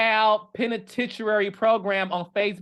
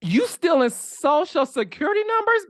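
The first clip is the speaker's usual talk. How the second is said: incredulous; 9 semitones higher, 4 dB louder than usual. Real, not acted. Incredulous at the charge.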